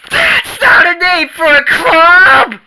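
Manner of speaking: high, loud indignant yell; outraged shout. Acted. Outraged protest at insult.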